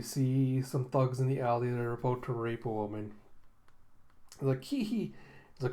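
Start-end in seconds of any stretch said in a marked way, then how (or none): 4.6-5.1 high, squeaky thug giggle; mock villain snicker. Thug snickers over his victim, high mock giggle. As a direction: titter like a cartoon thug. Thug's snicker voiced in retelling.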